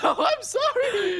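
high slow laughing sorry; laughing apology. Real, not acted. Laughing apology after cheating.

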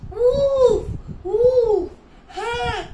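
loud, high cries of wolf; shouted scream. Acted. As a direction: yell out a false alarm. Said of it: "he shouted, screamed".

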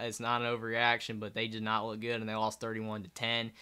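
ordinary talk

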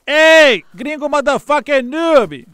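loud, slow, drawn-out taunt; taunting shout. Real, not acted. Streamer slowly jeering at the foreign player. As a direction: jeer at him, stretching every word.

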